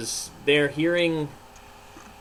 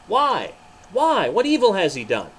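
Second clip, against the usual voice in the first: pleading why; 6 semitones higher, 6 dB louder than usual. Acted. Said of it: "Pilate cried".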